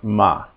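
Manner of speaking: "ma" with a short a; short "ma" as a syllable demo; short vowel. Shown as a demonstration. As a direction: keep the vowel of "ma" brief. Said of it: "the second one is short".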